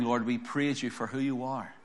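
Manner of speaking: ordinary talk, nothing marked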